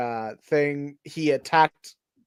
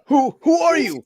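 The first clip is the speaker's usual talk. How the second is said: startled; 11 semitones higher, 4 dB louder than usual. Acted. Voicing a startled character.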